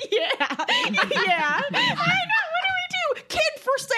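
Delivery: high, laughing panic; panicked bit. Retold or imitated. Panicked mother joking to a passing stranger, manic and high. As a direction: play manic joking over rising panic, far too high. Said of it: "I was so panicked".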